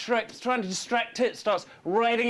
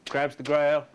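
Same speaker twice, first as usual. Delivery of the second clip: ordinary delivery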